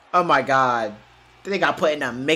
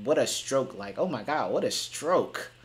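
imitating commentator; 4 semitones lower, 10 dB quieter than usual. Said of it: "but he's saying".

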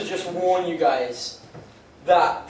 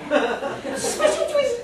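much higher, squeaky special treat; 14 semitones higher than usual. Acted. Comic teasing the crowd about the act, squeaky high voice. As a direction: squeak it like a tiny creature.

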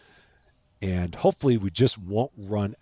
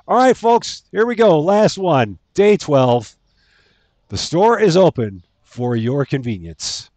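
excited announcer; 7 semitones higher, 9 dB louder than usual. Real, not acted. Announcing the final day.

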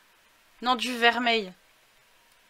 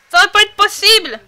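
loud, high indignant outburst; outraged shout; 9 semitones higher, 14 dB louder than usual. Real, not acted. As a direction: protest in mock outrage. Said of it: "il a une voix de fille?". (indignation criée et aiguë)

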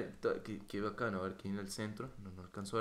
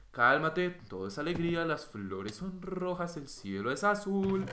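high, slow, forced-cheerful self-soothing; calma fingida; 6 semitones higher, 6 dB louder than usual. Pretend. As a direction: talk yourself down with exaggerated sweetness. Soothing himself after his rage. (calma fingida, lenta y aguda)

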